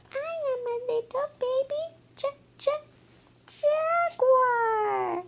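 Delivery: high little cub voice; baby jaguar voice. Acted. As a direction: speak as a tiny jaguar cub. Baby jaguar introduces itself.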